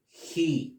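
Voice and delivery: "He" as a clear, held "hee"; pronunciation model. Shown as a demonstration. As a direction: model "He" for learners with a long ee. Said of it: "¿Y cómo se pronuncia?".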